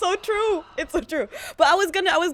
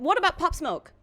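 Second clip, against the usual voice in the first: mock challenge; 8 semitones higher than usual. Real, not acted. Teasing interviewer pushes back.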